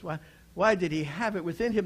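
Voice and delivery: usual speaking voice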